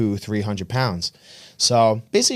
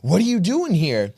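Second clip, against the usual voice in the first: incredulous bro; 6 semitones higher than usual. Retold or imitated. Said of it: "I go".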